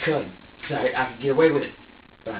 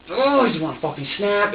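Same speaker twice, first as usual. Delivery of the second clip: loud, higher, about to snap; frustrated outburst; 7 semitones higher, 5 dB louder than usual. Retold or imitated. Parent at the end of their rope, frustrated outburst. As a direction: burst out in exasperation.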